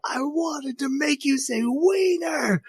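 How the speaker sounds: put-on very high, slow whiny girlish wail; frustrated blurt. Retelling Chika finally blurting it out in a high whine. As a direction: stretch it out high like an exasperated cartoon girl. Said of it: "she just blurts out".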